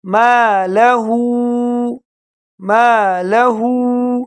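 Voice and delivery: ما لہو very slowly, ہو lengthened; stretched vowel. Shown as a demonstration. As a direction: draw out ہو by one alif. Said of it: "ایک الف کے برابر اس کو کھینچیں گے".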